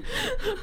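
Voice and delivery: a high, embarrassed squealing laugh. Real, not acted. Admitting her type to a friend, high embarrassed squeal.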